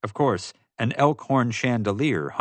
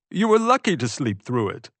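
higher-pitched, appalled character voice; exclaiming Pete; 4 semitones higher than usual. A narrator's reading. Pete recalling the horrible bear night to Ray, exclaiming. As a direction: exclaim it in an affronted, raised pitch. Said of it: "Pete exclaimed".

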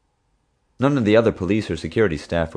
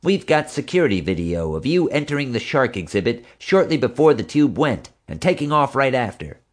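higher, harder cop voice; tough female cop; 7 semitones higher than usual. A narrator's reading. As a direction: press the evidence on him. Marge confronting suspect.

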